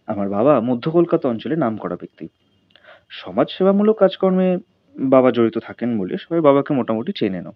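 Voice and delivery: ordinary delivery, nothing marked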